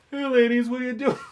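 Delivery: high-pitched catcalling impression; mock catcall. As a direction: imitate a kid calling to women, pitched right up. Imitates kids catcalling ladies.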